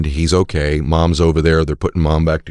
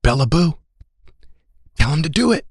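high teasing call; teasing college boy; 12 semitones higher than usual. Retold or imitated. Dorm boy teasing daughter, playful. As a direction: egg her on playfully. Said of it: "one of the boys goes".

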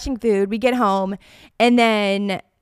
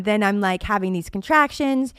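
normal talking voice

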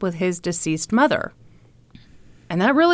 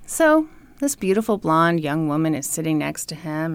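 usual speaking voice

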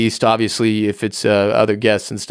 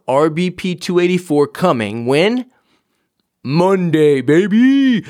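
hype-man announcement; 6 semitones higher, slower than usual. Acted. Podcast host hyping Monday's episode to listeners, pumped hype yell.

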